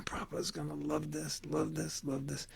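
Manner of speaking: hushed, eager whisper; whispered thought. Retold or imitated. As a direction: whisper it to yourself while stirring. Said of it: "and he's thinking".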